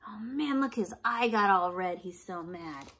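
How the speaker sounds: normal talking voice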